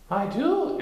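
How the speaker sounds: high small pig voice; timid Piglet. A narrator's reading. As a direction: squeak the plan anxiously.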